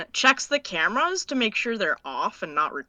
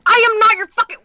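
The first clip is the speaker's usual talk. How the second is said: high, loud cut-off yell; furious outburst; 13 semitones higher, 10 dB louder, faster than usual. Acted. Sister yelling at her brother who calls it illegal, furious high shout. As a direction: explode at him mid-sentence.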